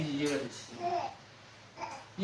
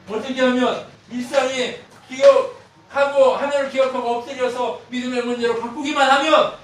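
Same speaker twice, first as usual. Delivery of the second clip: full-force preaching; 9 semitones higher, 13 dB louder than usual. Acted. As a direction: build it up and lean on every line.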